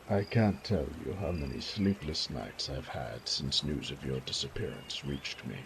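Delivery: normal talking voice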